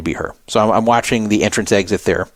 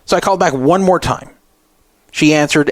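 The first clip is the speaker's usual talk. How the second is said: usual speaking voice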